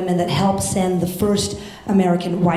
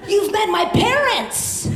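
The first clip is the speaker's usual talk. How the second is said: high, drawn-out disbelief; exasperated protest; 11 semitones higher than usual. Retold or imitated. To best friend who guessed Cherokee, exasperated protest. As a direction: plead incredulously, much higher and slower.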